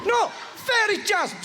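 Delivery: high, announcing the name; shouted name. Acted. Comedian correcting the crowd with a high shouted name. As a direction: call out the name grandly. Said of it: "Sorry, I shout that at you".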